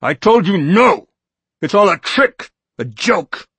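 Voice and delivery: high, loud, defiant shout; a frantic defiant yell; angry refusal. Acted. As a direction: shout him down. Norton refuses angrily.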